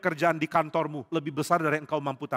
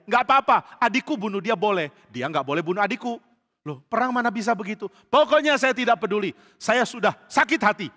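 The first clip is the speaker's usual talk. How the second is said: higher and much louder, insistent; stubborn anger; 6 semitones higher, 7 dB louder than usual. Acted. Joab insists to David that Abner must pay, in anger. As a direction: argue and refuse to budge.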